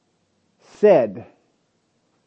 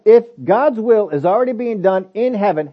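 normal talking voice

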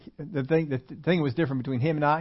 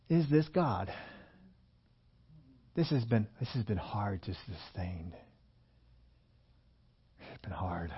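sinking into a low, slow, soft doubt; discouraged; 4 semitones lower, 6 dB quieter, slower than usual. Acted. Believer doubting after no quick results, discouraged and fading. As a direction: voice fading away as if worn down.

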